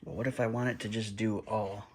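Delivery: ordinary talk, nothing marked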